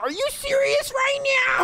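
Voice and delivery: slow, very high squeal; outraged disbelief. Pretend. Gamer confronts the plotting sisters, outraged. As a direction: stretch it out, scandalized.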